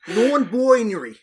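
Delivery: loud, high, drawn-out mock Australian accent; hammed-up Aussie twang with a falling end. Shown as a demonstration. Podcaster hams up the requested word in an exaggerated Australian accent. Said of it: "say the word non-binary in your most offensive".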